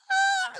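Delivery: very high, loud squawk; imitated clipping noise. Shown as a demonstration. Host showing listeners what clipping sounds like, piercing noise. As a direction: mimic horrible distorted audio. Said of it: "real crackly and horrible".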